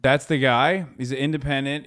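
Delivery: ordinary talk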